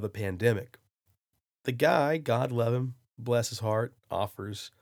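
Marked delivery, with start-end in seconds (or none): none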